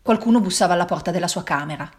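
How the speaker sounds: ordinary delivery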